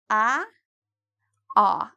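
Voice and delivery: ah with the lips pulled wide like a smile; wrong spread ah. Shown as a demonstration. Teacher showing learners the wrong spread ah. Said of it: "No, it's not spread".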